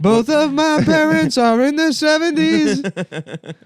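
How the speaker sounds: mocking loser voice. Mocking the gamer about his parents, high whine. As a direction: wail it while cracking up. Mocking an adult Pokemon fan.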